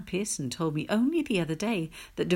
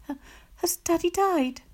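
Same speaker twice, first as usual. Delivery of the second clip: higher, stammering, scared question; faltering; 12 semitones higher, 5 dB louder than usual. Acted. Child fearfully asking her mum about Daddy, faltering. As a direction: falter on the first word in fear. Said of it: "I asked, faltering".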